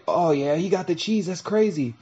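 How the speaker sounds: a hint of awestruck envy; awed onlookers. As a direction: sound dazzled by his cheese. Other mice admiring the winner.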